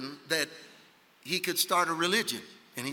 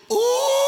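high, sustained crowd "oooh"; long high hoot of provocation; egging-on "ooh"; 8 dB louder than usual. Retold or imitated. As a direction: stir up a fight from the sidelines. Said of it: "the guy standing back that goes".

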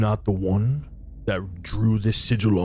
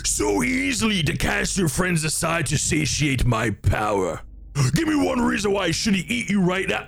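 higher, menacing demon voice; demon threat; 7 semitones higher than usual. Acted. Demon bunny threatening to eat the summoner, menacing. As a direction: threaten him grandly.

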